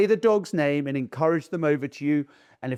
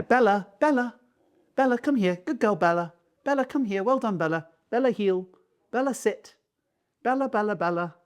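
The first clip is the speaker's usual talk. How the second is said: high-pitched calling to a dog; parodied owner repeatedly calling the dog; imitating dog owner; 7 semitones higher than usual. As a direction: sing out commands and praise as if to a pet.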